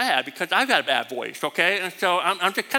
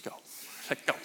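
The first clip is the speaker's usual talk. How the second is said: whispered; 12 dB quieter than usual. Retold or imitated. Whispered exchange near teacher's stall.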